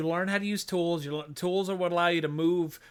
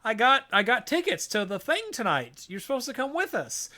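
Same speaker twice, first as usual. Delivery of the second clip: put-on much higher kid voice, eager then let down; young boy; 11 semitones higher than usual. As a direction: play a small boy pleading with his dad. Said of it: "Ben's like".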